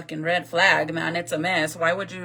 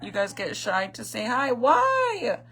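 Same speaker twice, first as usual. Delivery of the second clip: ordinary talk, nothing marked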